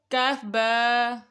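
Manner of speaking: stretches کاف با very slowly, each letter long; drawn-out recitation. Shown as a demonstration. Teacher repeating for children, very slow drawn spelling. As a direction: draw out کاف and با for the child to copy. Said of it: "اگلی دیکھتے ہیں line".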